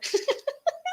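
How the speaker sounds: very high squeaky giggle. Real, not acted. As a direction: burst into a high-pitched giggle. Laughs at her own comparison.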